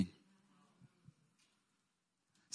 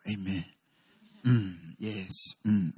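hushed, lower pious murmur, lightly voiced; lowered mock-pious amen and hum; mock super-spiritual voice; 9 semitones lower, 5 dB quieter than usual. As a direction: play the overly holy pastor, a touch hammed-up. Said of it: "like, really spiritual".

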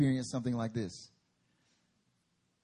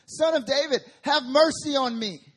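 yelling out; 10 semitones higher, 10 dB louder than usual. Acted. Blind Bartimaeus calling out to Jesus, loud desperate yell. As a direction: shout it across the crowd. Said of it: "he's yelling out".

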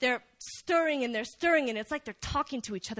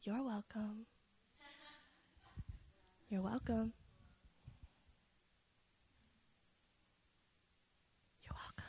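very soft, understated murmur; hushed and fading away; God's gentle reply; 15 dB quieter than usual. Acted. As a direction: say it very softly, fading with each repeat. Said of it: "I feel like God says".

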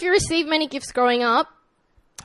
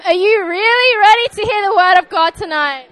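loud excited call; 7 semitones higher, 11 dB louder than usual. Shown as a demonstration. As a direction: shout it, high and loud. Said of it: "Ask them".

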